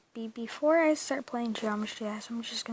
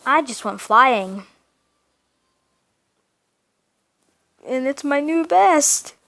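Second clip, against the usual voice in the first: excited; 3 semitones higher, 13 dB louder than usual. Real, not acted. Excited gamer after new best.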